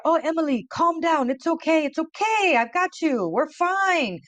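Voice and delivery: high, soothing voice of the cab driver; calm her down like a kind driver; the cab driver soothing a panicking Emily, high and gentle; reassuring cab driver. Retold or imitated. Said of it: "he would clean the car".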